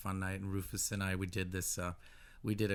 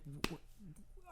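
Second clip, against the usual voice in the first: stunned disbelief; 8 semitones higher than usual. Retold or imitated. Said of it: "I was just like".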